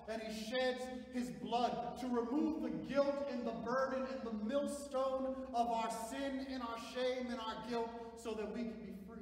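loud, high, slow proclamation; full-force preaching. Acted. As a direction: ring it out to the congregation.

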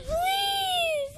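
very high, broken crying wail; wailing plea. Retold or imitated. Child wailing please to the Lord, crying. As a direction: let the voice crack up into a wail.

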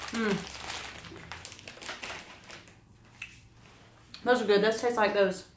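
chewing while talking; mouth full. Real, not acted. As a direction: hand over mouth. Seen 0: Chewing before; mid-speech grimace, hand covers mouth as if eating.

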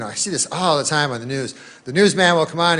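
ordinary delivery

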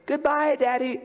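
put-on high, loud little boy's goodbye. Son waving to his father, high bright child voice. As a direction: call it out like a cheerful small son.

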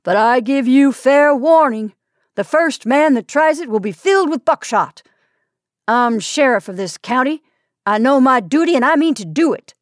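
loud, firm sheriff calling out. A narrator's reading. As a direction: raise your voice so the whole crowd hears. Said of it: "raising his voice so that all could hear".

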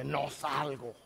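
low, defiant demon refusal. Retold or imitated. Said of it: "Y el demonio dice". (voz de demonio grave y desafiante)

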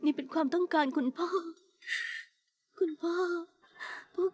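soft murmur with long pauses; hushed, half-asleep mumbling; drowsy murmur. Acted. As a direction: drift off while still insisting. Murmuring as she falls asleep.

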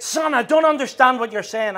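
loud high baffled reply; a half-deaf dad calling back; dad shouting back. Retold or imitated. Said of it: "He said".

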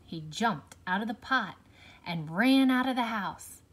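plain reading voice

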